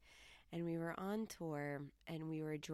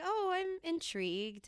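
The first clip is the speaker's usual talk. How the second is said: intrigued; 12 semitones higher, 5 dB louder than usual. Retold or imitated. Reacting to clerk's weed talk.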